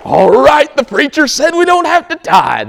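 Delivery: high, loud mocking voice; mock misquote. Mocking what the congregation wishes the preacher said, high and loud. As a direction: squeak out the wrong version for laughs. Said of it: "No, that's not it".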